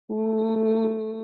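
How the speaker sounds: long breathy woo on the out breath; woo exhale sound. Shown as a demonstration. Therapist demonstrating to the host, a long exhaled woo. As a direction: let the stress go with a drawn-out woo. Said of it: "So it's gonna look like this".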